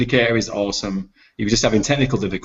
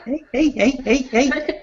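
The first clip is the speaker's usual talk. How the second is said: mock hushing; slower than usual. Mock calming outburst.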